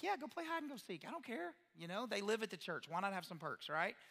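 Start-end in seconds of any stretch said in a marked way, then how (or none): 0.0-1.5 breezy high don't-care voice, much higher; carefree. Retold or imitated. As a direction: toss it off like a relaxed dad letting the kids run. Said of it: "I'm like".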